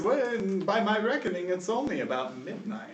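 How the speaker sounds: put-on high, doddering old-man voice; old governor. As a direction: muse it mildly, slowing at the end. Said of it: "He looks at the sky and says".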